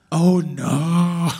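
usual speaking voice

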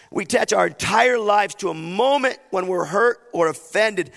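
high, emphatic sermon delivery; impassioned preaching. Real, not acted. Preacher explaining forgiveness to the church, high and impassioned. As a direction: drive the point home to the congregation.

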